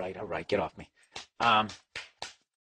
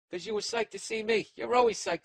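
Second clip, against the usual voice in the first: high-pitched cooing to a pet; doggy talk. Real, not acted. Owner teasing his excited dog, high doggy talk. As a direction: coo it way up high.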